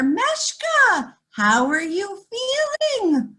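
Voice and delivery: high, lifting call of Meshka; a lightly voiced friendly baker; kindly baker. Baker greeting Meshka in a high, lifted voice.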